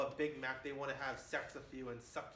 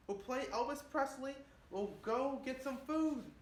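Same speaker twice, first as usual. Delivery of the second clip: higher and louder, fed up with her; raised exasperated voice, then slowing to placate; exasperated outburst; 9 semitones higher, 4 dB louder than usual. Retold or imitated. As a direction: burst out, fed up with the endless food talk. Exasperated boyfriend answers girlfriend.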